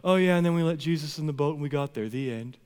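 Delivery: rushed, offhand throwaway, dropping at the end; imitating a casual wrap-up, fast and unexcited; anticlimactic retelling. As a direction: toss it off as a mock afterthought. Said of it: "and then John just goes".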